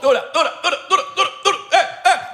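high, laughing words with a mic echo. Real, not acted. Host laughing high into an echo effect about Gini's community. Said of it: "Ah, el eco".

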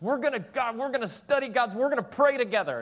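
mock-earnest push to get devotions going; determined urging. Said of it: "she's fighting for devotions".

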